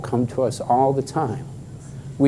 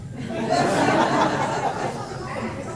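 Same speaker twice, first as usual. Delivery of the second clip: breathy laugh; 6 dB quieter than usual. Real, not acted. Laughs about tattoo idea.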